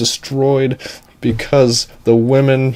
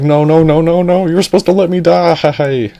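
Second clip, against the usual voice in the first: high, loud mock wailing protest; hammed-up whine, pitched way up; distraught damsel; 8 semitones higher, 4 dB louder than usual. Damsel upset at being rescued, high wailing protest.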